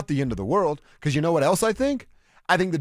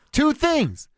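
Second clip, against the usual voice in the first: excited; 12 semitones higher than usual. Real, not acted. Emphatic point, very high.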